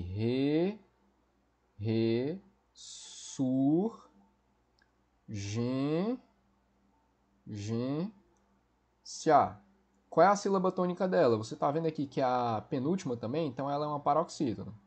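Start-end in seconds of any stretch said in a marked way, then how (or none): 0.0-9.6 Re, re, sur, gen, gen, se a each said apart with pauses; syllable by syllable. Shown as a demonstration. Teacher splitting ressurgência into syllables for students, deliberate and slow. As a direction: chop ressurgência into separate syllables. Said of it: "Vamos separar aqui".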